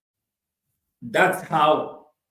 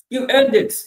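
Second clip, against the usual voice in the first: loud affirmation; 7 semitones higher, 8 dB louder than usual. Shown as a demonstration.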